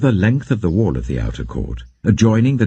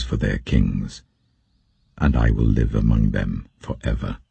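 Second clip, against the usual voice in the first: lower, solemn divine voice speaking from the temple; the Lord addressing the prophet, lower and solemn; voice of God; 7 semitones lower than usual. Acted. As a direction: read as the Lord, lower and measured.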